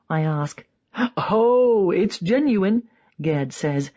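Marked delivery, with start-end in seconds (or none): 1.2-2.9 beam like a daytime TV host; twinkling TV host. A narrator's reading. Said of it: "twinkling like a daytime television host".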